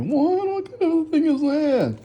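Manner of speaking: high, incredulous squeak of disbelief; baffled what. Real, not acted. Baffled by gifted bathroom.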